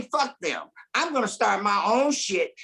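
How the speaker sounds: ordinary delivery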